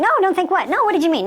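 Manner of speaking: high, insistent comeback; pressing mock-incredulous retort; mocking challenge. Shown as a demonstration. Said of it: "you mock it and pick it apart".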